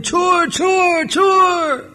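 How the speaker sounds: very high, drawn-out mock yell; shouting. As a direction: imitate an alarm cry, high but not full force. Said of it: "he was shouting".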